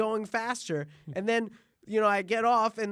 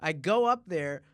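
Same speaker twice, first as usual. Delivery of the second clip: ordinary delivery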